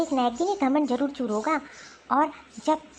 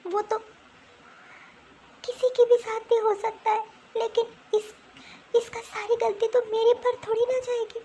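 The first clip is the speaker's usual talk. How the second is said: tearful; 5 semitones higher than usual. Acted. Daughter-in-law crying to her husband about blame. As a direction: voice breaking with tears.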